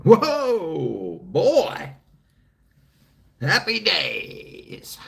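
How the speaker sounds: loud, high "whoa", then a slow, drawn-out "happy days"; hammed-up marvelling at something looking huge; mock amazement. Joking about magnifying reading glasses on the toilet, mock amazed. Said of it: "I look down and go".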